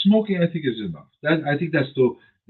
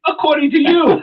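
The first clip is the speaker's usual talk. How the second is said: sarcastic; 4 dB louder than usual. Real, not acted. Teasing retort to cohost.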